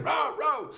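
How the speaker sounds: high-pitched mock barking; two high woofs, voiced by a person; imitated dog bark. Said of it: "and he's barking".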